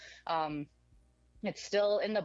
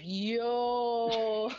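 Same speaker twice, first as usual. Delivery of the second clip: long drawn-out, high "yo"; retold stunned reaction; 5 semitones higher, 4 dB louder than usual. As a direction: stretch it out in mock disbelief. His stunned reply in story.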